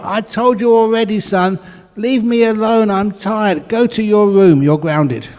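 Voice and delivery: high, loud, fed-up telling off; father shouting at his son to leave him alone; angry father. Acted. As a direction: blow up and send him to his room. Father loses temper at son.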